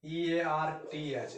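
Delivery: ई ए आर टी एच spelled slowly, one letter at a time; slow spelling. Shown as a demonstration. Teacher slowly spells earth for students. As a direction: spell out ई ए आर टी एच carefully. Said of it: "इसको क्या बोलते हैं?".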